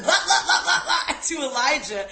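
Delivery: laughing imitation of someone wigging out; mock ranting. Host mimics her cousin yelling at Elijah, mock ranting. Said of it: "literally yelling".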